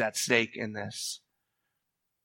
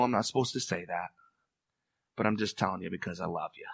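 soft, fast, intimate aside; tender aside; 6 semitones lower, 9 dB quieter, faster than usual. Real, not acted. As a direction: drop the voice to a confiding hush. Softly earnest aside to congregation.

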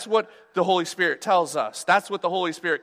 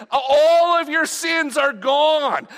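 slow, high, loud proclamation; triumphant pulpit shout; proclaiming shout; 9 semitones higher, 5 dB louder, slower than usual. Acted. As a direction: preach it out big and slow to the room. Preacher proclaims forgiveness.

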